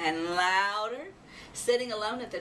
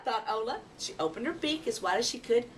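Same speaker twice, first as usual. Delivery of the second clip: ordinary delivery, nothing marked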